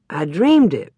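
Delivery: ordinary delivery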